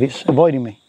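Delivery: normal talking voice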